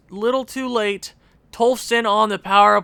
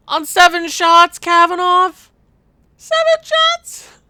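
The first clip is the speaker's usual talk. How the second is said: much higher and louder, stretched out; outraged disbelief; 10 semitones higher, 7 dB louder than usual. Real, not acted. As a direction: scream your disbelief at the screen. Outraged at goalie stats.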